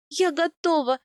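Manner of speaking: high, strained declaration; strained, emotional plea. A narrator's reading. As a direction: say it through pain, as a farewell. Wife in agony pleads to husband.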